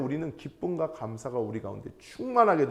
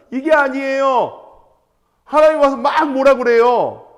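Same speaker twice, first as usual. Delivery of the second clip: much louder and higher, slow, punching each word; emphatic exclamation; 9 semitones higher, 12 dB louder than usual. Real, not acted. Preacher to the congregation, loudly exclaiming God scolded Job. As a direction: declare it loudly, with disbelief.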